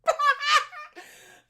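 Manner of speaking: high, loud burst of helpless laughter; shrieking laugh. Acted. Daughter bursts out laughing at Jack, shrieking.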